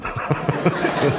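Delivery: amused burst of laughter; high, hearty chuckling. Real, not acted. Amused by guest's tax joke.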